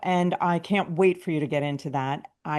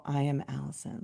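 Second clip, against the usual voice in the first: much softer, murmured under the breath; said quietly; 3 semitones lower, 10 dB quieter than usual. Shown as a demonstration. Affirmation said softly near other people at the gym. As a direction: keep it down so people nearby don't hear. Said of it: "Like, I just say it quietly".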